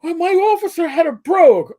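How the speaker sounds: mock Irish brogue, high and loud; a driver in court doing a stage-Irish accent; lightly hammed Irish brogue. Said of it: "keep the accent up".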